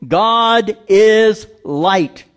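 "God is light" drawn out very slowly; slow solemn declaration; slow emphatic. Acted. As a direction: let each word land. Preacher emphasizes key verse.

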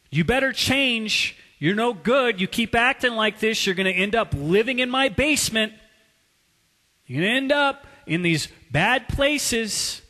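scolding father. Retold or imitated. Preacher imitating his father warning him as a teenager, mildly scolding. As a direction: press the warning like a critical parent. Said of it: "voices I heard as a teenager from my father".

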